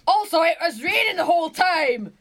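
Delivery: hammed-up Glasgow accent, high and emphatic; granddad's Glasgow accent. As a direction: read it as a Glaswegian granddad. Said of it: "do it in his accent".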